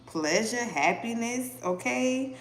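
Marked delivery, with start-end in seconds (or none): none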